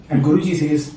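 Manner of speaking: ordinary delivery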